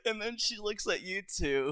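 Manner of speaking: ordinary delivery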